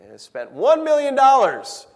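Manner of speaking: indignant slow emphasis; outraged emphasis. Acted. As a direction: hammer home the outrage. Outraged sermon point on waste.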